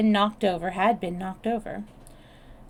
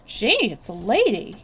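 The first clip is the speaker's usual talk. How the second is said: exclaiming; 2 semitones higher, 7 dB louder than usual. A narrator's reading. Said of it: "he exclaimed".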